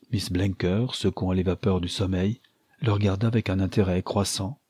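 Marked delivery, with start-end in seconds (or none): none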